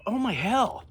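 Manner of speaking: high-pitched panicked friend; imitated panic. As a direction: gasp it out like a panicked buddy. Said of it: "he's just panicked".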